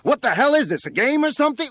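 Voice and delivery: high, very loud exasperated yell; winded fireman yelling. A narrator's reading. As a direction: bellow the indignant question upward. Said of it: "the winded fireman yelled".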